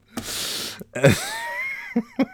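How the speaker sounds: high, helpless laugh. Real, not acted. Storyteller cracking up at being banished, laughing. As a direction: burst out in a long high laugh.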